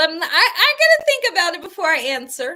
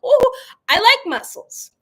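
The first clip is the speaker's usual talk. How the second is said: delighted; 8 semitones higher, 6 dB louder than usual. Real, not acted. Delight over mussels.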